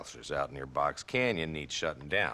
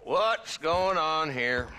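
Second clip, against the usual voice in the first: loud, slow, higher call to break it up; stern demand; 9 semitones higher, 4 dB louder, much slower than usual. Acted. Marshal breaking up a gunpoint standoff, loud and slow. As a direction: stop them cold with authority.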